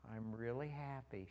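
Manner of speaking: granddaughter's slow, unhurried speech; slow quoted reply; imitating slow talker. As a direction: take your time on each word, imitating her. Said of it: "She talks kind of slow sometimes".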